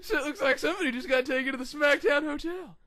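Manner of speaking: high, loud jeering yell with a laugh; gloating shout. Retold or imitated. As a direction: bellow the trash talk. Wrestler gloating at Frank Mir.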